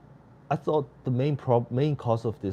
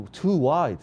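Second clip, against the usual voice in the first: excited; 6 semitones higher, 6 dB louder than usual. Real, not acted. Shot goes wide.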